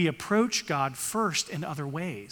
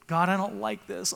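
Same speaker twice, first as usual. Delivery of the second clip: mock whiny prayer, pitched higher; whining; 4 semitones higher than usual. Preacher imitating a believer whining to God, higher mock whine. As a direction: whine it like a sulky child to God. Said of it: "come to God whining".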